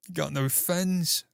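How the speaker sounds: slow, drawn-out Cockney; mock Cockney threat, slowly; Cockney quote. Repeating a Cockney fan's line, slowly. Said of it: "Little Cockney guy".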